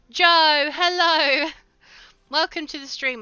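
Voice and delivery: loud, high, happy hello; excited greeting. Real, not acted. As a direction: shout a delighted welcome. Greeting a viewer joining the stream.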